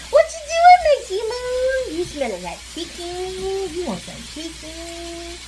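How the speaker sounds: put-on high cooing baby talk to the dog. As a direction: coo at the pet, high and sweet. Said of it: "in that baby talk voice".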